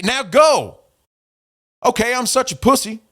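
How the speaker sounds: high-pitched sarcastic giving in; mock surrender. Reads sarcastic exchange from DMs.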